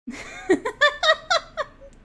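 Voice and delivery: squealing giggle fit; helpless high laugh; high-pitched laughter. Real, not acted. Can't stop laughing at mistake.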